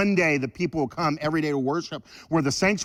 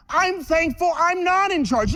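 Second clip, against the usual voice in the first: ordinary talk, nothing marked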